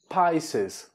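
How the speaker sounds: "Pisces" drawn out as PIE-seez; English pronunciation. Shown as a demonstration. Teacher says the sign isn't fish, slow English pronunciation. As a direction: model "Pisces" slowly in English. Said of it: "O signo de Peixes não é".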